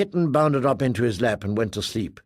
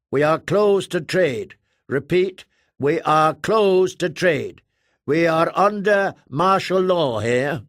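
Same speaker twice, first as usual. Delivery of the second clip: higher, louder, slow and clipped refusal; firm control officer; 5 semitones higher, 4 dB louder, slower than usual. Acted. Namor Control refusing trade under martial law, firm. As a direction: shut the door on them.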